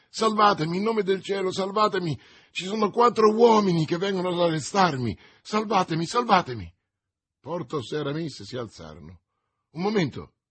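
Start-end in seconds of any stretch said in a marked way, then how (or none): 0.1-6.7 high, loud, desperate begging; exclaimed plea. A narrator's reading. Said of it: "esclamò". 9.7-10.3 raised, firm call to halt; exclaimed interruption. A narrator's reading. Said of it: "esclamò D'Artagnan".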